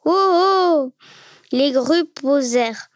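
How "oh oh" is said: drawn-out, higher-pitched startling call. A narrator's reading. Corrado claps to startle the cranes, calling out. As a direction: raise the pitch and holler to scare birds off. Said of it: "Il frappa dans ses mains en criant".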